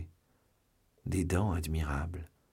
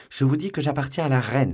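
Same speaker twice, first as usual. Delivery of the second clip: higher, louder desperate cry; 6 semitones higher, 6 dB louder than usual. A narrator's reading. Madame Bonacieux crying out to her captors, desperate and loud. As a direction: fling it at them as a last defence. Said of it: "s'écria la malheureuse femme".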